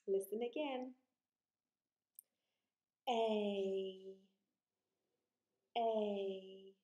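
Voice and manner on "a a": A drawn out, gliding as it goes; long vowel A. Shown as a demonstration. Teacher shows children the long sound, A stretched out. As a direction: hold the letter name A long. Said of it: "I'm gonna say a long vowel sound".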